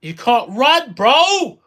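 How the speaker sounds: loud taunt, rising into a yell; taunting shout. Real, not acted. Gamer taunts an enemy in the fight, loud shout. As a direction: shout it at a fleeing enemy, biggest on "bro".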